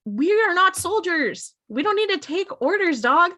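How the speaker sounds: high, indignant pushback; a younger brother snapping back; defiant retort. Retold or imitated. Sam refusing Dean's orders, high and indignant.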